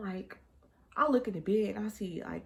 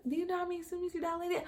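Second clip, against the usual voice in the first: high, quick questioning voice; mimicked hospital staff; 12 semitones higher, much faster than usual. Hospital staff to woman in labour, mimicked questioning. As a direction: imitate a clerk in a high, rushed voice.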